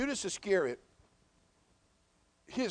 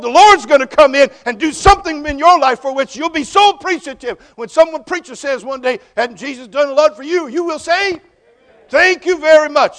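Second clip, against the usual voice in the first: loud, high, driving preacher's call; preacher drives it home to the congregation at full voice; full-force preaching; 8 semitones higher, 21 dB louder than usual. Acted. Pastor pressing the congregation, loud and high preaching.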